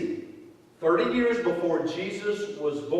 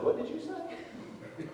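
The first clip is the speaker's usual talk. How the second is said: higher, hushed and slow, as if he misheard; baffled hushed question; 11 semitones higher, 12 dB quieter, slower than usual. Retold or imitated. Pastor retelling his confusion to his daughter, hushed and baffled. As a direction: breathe out the question in disbelief. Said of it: "First of all, I said".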